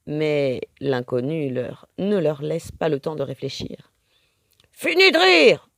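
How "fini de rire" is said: loud, high threatening snarl; the pirate woman threatens her prisoners loudly; menacing growl. A narrator's reading. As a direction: threaten them, the fun is over. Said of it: "gronde-t-elle d'une voix menaçante".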